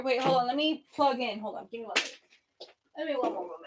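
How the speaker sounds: ordinary talk, nothing marked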